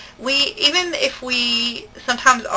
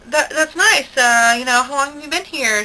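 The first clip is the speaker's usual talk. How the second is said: fake polite interest; 5 semitones higher, 4 dB louder, slower than usual. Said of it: "we were like".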